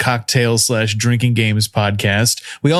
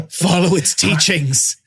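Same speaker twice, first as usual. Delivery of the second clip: overacted death scene; 9 semitones higher than usual. Mock dying last words.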